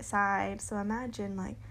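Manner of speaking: ordinary talk, nothing marked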